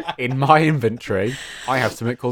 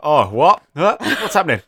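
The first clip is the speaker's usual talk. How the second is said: startled, louder, rising confusion; dazzled and baffled by the light; confused squint; 4 semitones higher, 6 dB louder than usual. Retold or imitated. Ark blinded by the torch, startled confusion. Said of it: "He's like".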